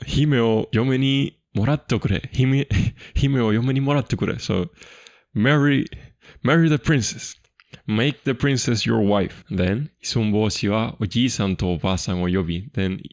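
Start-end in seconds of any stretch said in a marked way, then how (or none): 0.1-4.4 eager minister. Retold or imitated. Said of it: "that's the daijin talking". 5.3-9.4 urging minister. Retold or imitated. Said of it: "that's the minister talking".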